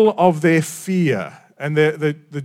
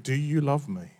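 gentle question; 11 dB quieter than usual. Acted. Jesus simply asks Peter.

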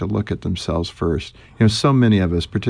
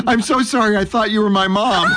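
put-on high, hurried sorry from a startled child; the boy says sorry to the stranger, flustered and high; flustered apology; 11 semitones higher than usual. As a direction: blurt it out, mortified. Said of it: "I said".

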